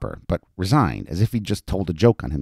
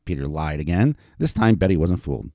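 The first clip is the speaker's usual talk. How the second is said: plain reading voice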